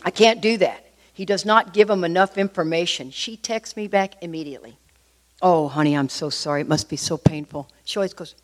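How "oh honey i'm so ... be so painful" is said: mock sympathy. Sponsor texts back to crying wife, soothing sympathy. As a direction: coo it gently, setting up the blow.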